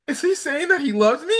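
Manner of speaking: high put-on smitten girl voice; blissfully lovestruck. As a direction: imitate a girl swooning with hope. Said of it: "she's just in freaking bliss of love".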